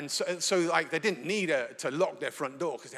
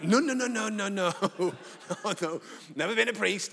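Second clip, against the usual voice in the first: higher, laughing protest of no; flustered denial; 4 semitones higher than usual. Retold or imitated. The preacher hastily denies being a priest to the room. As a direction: wave it off in a flustered rush.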